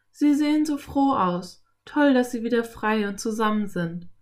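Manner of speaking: put-on high, glad girl's voice; happy girl. A narrator's reading. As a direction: play the girl delighted for the horses.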